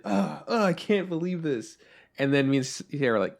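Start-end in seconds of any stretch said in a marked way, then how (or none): 0.0-1.8 mock dismay. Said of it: "he's like".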